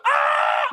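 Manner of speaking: high laughing yell; hammed-up winner's yell; mock victory scream. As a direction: scream with joy like you just won. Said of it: "be like".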